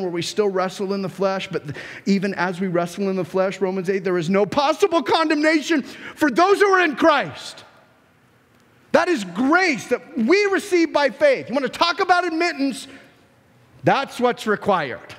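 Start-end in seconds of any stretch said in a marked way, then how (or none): 4.0-7.6 high, forceful preacher's cry; ringing, emphatic declaration; emphatic proclamation. Shown as a demonstration. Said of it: "It could not be stated more emphatically". 8.9-15.1 high, impassioned pulpit delivery; emphatic preacher's proclamation; forceful preaching. Acted.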